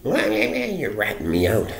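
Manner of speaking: slow, high drawn-out whine; mocking drunk. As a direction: stretch it out like a sulky drunk. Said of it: "he said".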